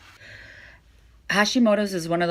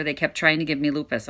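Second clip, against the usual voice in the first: ordinary talk, nothing marked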